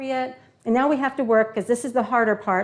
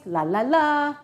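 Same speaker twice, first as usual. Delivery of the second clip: la-la-la sliding up high; lilting, rising la, la, la; playful vocalizing; 4 semitones higher, 4 dB louder, slower than usual. Shown as a demonstration. Teacher showing the class the voice, playful rising lilt.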